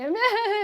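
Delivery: high, loud imitated group laughter; mocking laugh. Ladies laughing at the plant-based guest, imitated mocking laugh. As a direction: imitate women laughing at someone. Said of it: "they start laughing at me".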